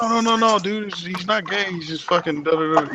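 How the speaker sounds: high, fast mock reassurance; imitating Japanese friends. Japanese friends calming things at the club, high mock reassurance. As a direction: play friends smoothing over a tense club moment. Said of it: "be my Japanese people like".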